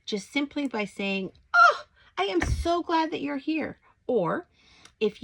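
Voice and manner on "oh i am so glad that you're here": gushing greeting. Shown as a demonstration. Modelling an extreme greeting.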